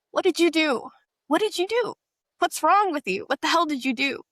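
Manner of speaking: high-pitched, retold angry demand; frantic accusation. Caller blaming her over the phone, frantic accusation.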